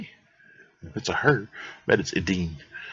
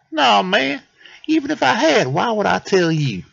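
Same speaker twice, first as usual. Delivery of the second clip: high, loud dismissive squirrel voice; squirrel character; 11 semitones higher, 5 dB louder than usual. Acted. Squirrel refuses the detective's question, high cheeky voice. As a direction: brush him off in a high voice.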